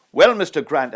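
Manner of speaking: high, booming call across the set; loud voice. Retold or imitated. Actor calling his film line at Kirk Douglas, booming. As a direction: project it loud to the back of the lot. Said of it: "That voice, so loud".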